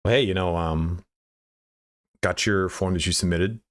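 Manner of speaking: imitating a boss's relaxed, halting call; lightly voiced unhurried phone salesman; calm mentor's sales delivery. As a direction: ease into the point with casual pauses. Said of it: "that's exactly how he used to speak".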